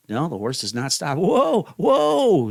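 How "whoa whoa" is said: much higher and louder shouts; panicked whoa. Acted. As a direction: yell at the horse to stop. Runaway horse near cliff.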